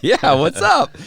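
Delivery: high, loud retold hello; playful, high-pitched reply; hearty greeting. Himself greeting the fan, retold.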